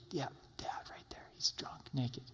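hushed, secretive whisper to the brothers; gossipy whisper. Acted. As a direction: whisper it like spreading juicy gossip. Son gossiping about drunk father.

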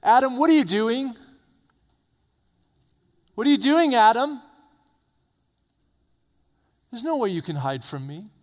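high, loud calling voice; God calling to hiding Adam in the garden. Acted. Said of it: "And God says".

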